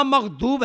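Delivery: مغضوب with d in place of ض; wrong d. Shown as a demonstration. Teacher rejecting the d reading, d in مغضوب. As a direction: say magh-doob for مغضوب. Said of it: "دال سے بدلتے ہیں".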